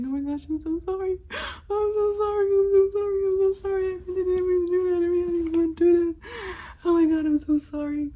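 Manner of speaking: high, loud, sobbing sorry, voice breaking; crying apology. Retold or imitated. Teen girl sobbing apologies to her boyfriend after the shot.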